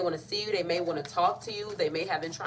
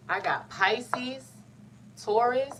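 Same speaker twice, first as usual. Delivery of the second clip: dramatic reveal; 5 semitones higher, much slower than usual. Real, not acted.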